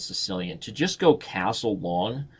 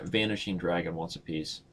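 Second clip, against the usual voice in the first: low teasing challenge to the viewer; soft-spoken dare; 3 semitones lower, 6 dB quieter than usual. Pretend. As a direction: dare him softly to play. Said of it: "Let's see if Va".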